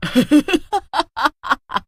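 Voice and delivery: high evil cackle of a witch. Acted. Witch cackles after line. (चुड़ैल की बुरी हँसी)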